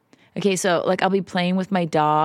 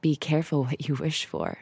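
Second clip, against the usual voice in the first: wry gasp; 5 dB quieter, slower than usual. Retold or imitated. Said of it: "we're like".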